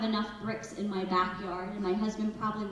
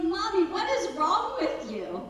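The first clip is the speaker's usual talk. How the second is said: put-on higher, louder voice of a puzzled child; upset son; 8 semitones higher, 5 dB louder than usual. Son confronts his oddly calm mother, imitated puzzled child. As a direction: imitate a young son questioning mom. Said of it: "He's like".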